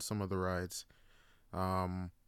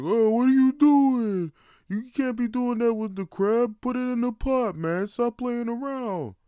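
much higher, loud exasperated yell; scolding dad; 14 semitones higher, 11 dB louder than usual. Retold or imitated. Dad yelling at his son to put the crab in the pot. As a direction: yell at the kid to stop messing about.

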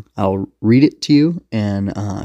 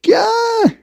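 very high-pitched startled shriek; terrified yelp under fire; sudden acted squeal of fright; scream; 4 dB louder than usual. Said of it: "A G screamed".

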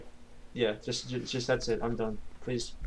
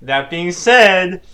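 loud and very slow, every word drawn out; slow loud proclamation; 5 semitones higher, 12 dB louder, much slower than usual. Acted. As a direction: boom it out like a grand announcement. Friend mocks the catchphrase.

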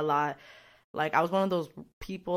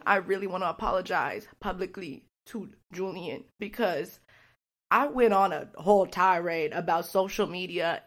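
ordinary talk

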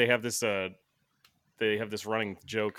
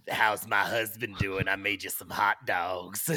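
man's voice as a wife; 2 semitones lower, slower than usual. Retold or imitated. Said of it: "she has a man's voice".

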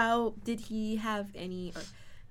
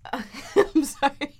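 laughing; 4 semitones higher, 8 dB louder than usual. Real, not acted. Laughing apology.

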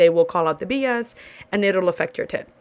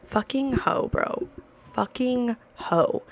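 softer, slow grumble under the breath; quiet muttered insult; 3 semitones higher, 6 dB quieter, slower than usual. Real, not acted. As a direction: mutter it to yourself, annoyed.

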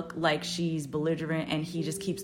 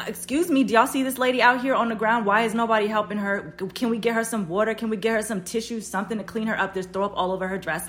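raised, louder urgent demands to bar staff; pissed-off appeal; 4 semitones higher, 5 dB louder than usual. Retold or imitated. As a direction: re-enact an indignant plea for help. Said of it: "'cause I'm pissed".

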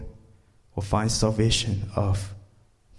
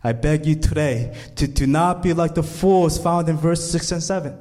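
ordinary delivery

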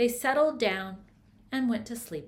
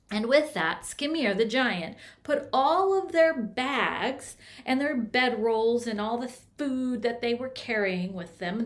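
ordinary delivery

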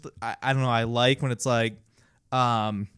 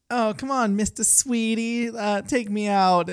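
put-on old-timey women; 9 semitones higher than usual. Imitating women coaxing a man out, high wheedling voice. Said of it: "like, the women, they'd be like".

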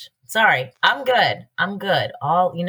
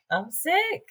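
much higher, softer repeat; high-pitched squeak; 11 semitones higher, 5 dB quieter than usual. Real, not acted. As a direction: squeal it in disbelief.

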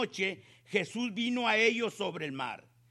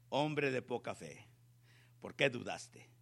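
lower, softer rebuke; gentle rebuke; 10 semitones lower, 9 dB quieter than usual. A narrator's reading. Jesus quietly rebukes sinking Peter, lower and softer. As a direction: chide him gently, voice dropped low. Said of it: "Jesús extiende la mano".

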